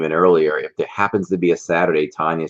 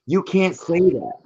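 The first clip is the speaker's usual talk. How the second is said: mock scolding; 12 semitones higher than usual. Teasing text read out.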